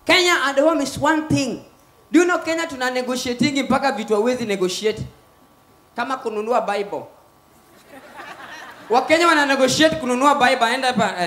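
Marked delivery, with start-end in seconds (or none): none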